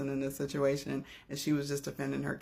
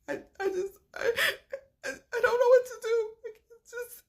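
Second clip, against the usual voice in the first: imitating the crying mom; 4 dB louder than usual. Impersonating the crying mother.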